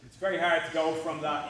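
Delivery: ordinary delivery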